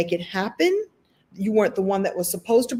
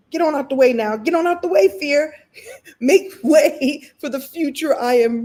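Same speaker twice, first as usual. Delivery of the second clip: louder and much higher, pushing fear aside; shooing fear away; 6 semitones higher, 4 dB louder than usual. Pretend. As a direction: tell fear to move, with spunk. Shooing fear away in self-talk.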